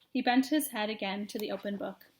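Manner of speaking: ordinary delivery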